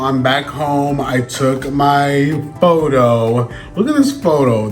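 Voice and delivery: ordinary delivery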